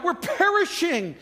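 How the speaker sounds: high, drawn-out cry of alarm; mock panic. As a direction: wail it like a panicked disciple, hammed up. Panicked disciples voiced in sermon.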